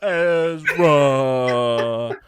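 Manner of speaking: long wailing whale call, loud and drawn out; hammed-up whale-speak, vowels held endlessly; Dory speaking whale. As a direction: stretch every word into a huge swooping moan, starting high and sinking. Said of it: "Ahsoka did her best, uh, Dory impression".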